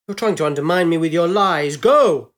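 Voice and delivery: loud outburst building to a shouted order; blustering boss exploding at an official; furious accusation. A narrator's reading. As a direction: berate them, then yell the last word. Big man throws out scientific officer.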